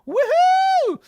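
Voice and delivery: a loud, very high whoop; loud high celebratory whoop; excited cheer. Real, not acted. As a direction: whoop it out like a win. Cheering at chat.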